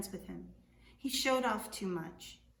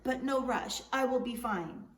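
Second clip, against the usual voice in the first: loud, high, breezy call; calling after Juan, carefree; yelling out; 4 semitones higher, 7 dB louder than usual. Acted. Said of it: "he yelled out to Juan".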